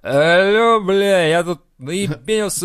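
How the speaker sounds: put-on loud, slow, slurring drunk. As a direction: call in, wasted and loud. Drunk call to alcoholics hotline.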